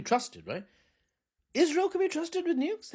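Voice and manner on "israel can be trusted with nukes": sarcastic. Acted. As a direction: say it as an obvious lie. Sarcastic repeated refrain.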